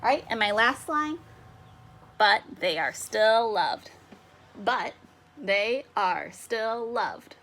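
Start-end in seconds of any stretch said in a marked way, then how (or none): 2.2-3.9 slow, louder, in one connected phrase; deliberate line reading. Shown as a demonstration. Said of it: "Five syllables again". 4.6-7.3 very slow, paused after "But"; syllable by syllable. Shown as a demonstration. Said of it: "Five syllables again".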